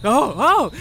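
high crowd gasps; acted alarmed onlookers; startled crowd. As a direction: exclaim high like shocked bystanders. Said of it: "The, the crowd is like".

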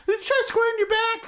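high, eager cartoon helper voice, laughing through it; Mister Meeksy voice. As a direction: squeak it up like a chipper little helper who exists to serve. Voicing Meeseeks character.

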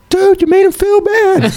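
very high, slow, scolding whine; mock falsetto reproach; whiny friend voice. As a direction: whine it like an exasperated buddy. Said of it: "he goes".

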